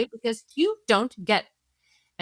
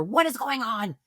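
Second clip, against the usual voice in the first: angry parent; 5 semitones higher than usual. Retold or imitated. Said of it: "is with anger".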